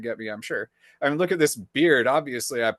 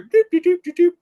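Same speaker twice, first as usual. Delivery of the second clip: typing sounds; 8 dB louder, faster than usual. Retold or imitated. Imitating typing.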